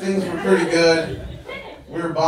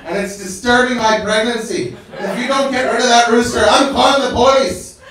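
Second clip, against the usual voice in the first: angry neighbour; 8 semitones higher, 6 dB louder than usual. Retold or imitated. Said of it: "She's like".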